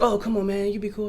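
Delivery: high, wheedling imitation of a fan; pleading. Refused fan asking him to be cool, pleading high. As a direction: imitate someone begging a favour, pitched well up.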